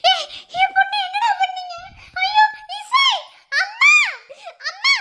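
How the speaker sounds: high, distraught cries; panicked mother. Acted. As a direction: cry out in alarm for your child. Mother finds daughter collapsed.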